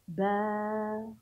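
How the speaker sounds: با with a long, held aa; lengthened sound. Shown as a demonstration. Teacher showing pupils the stretched ba sound, drawn-out با. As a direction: hold the vowel of با evenly. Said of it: "تمديد صوت الباء".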